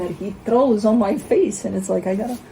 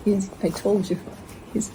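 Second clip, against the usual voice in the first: ordinary delivery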